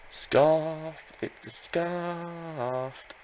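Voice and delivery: gasp, then a high drawn-out word of shock; a sharp gasp that stretches into slow disbelief. Real, not acted. Realizing the Ditto holds a scarf, gasping slow shock.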